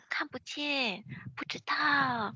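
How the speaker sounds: high, soft, secretive voice; imitated whispering caller. Interpreter imitates caller who can't see in the dark, hushed. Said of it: "she was whispering".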